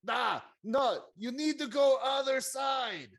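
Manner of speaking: loud, high-pitched indignant referee; Bruno. Acted. Objecting to the player's move as Bruno, loud and high. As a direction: shout the correction high and forceful. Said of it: "Bruno says".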